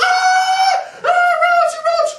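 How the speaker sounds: piercing, very high screams; mock screaming. Date spotting a roach at dinner, hammed-up scream. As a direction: shriek it like a terrified date. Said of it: "She screams".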